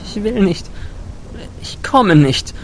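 put-on high, girlish defiance hiding fear; frightened but defiant, high-pitched; defiant undertone. A narrator's reading. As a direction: refuse stubbornly in a small high voice. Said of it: "mit trotzig klingendem Unterton".

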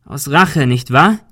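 loud, accusing challenge; calling out. A narrator's reading. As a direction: shout the accusation at him. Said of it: "rief Ben".

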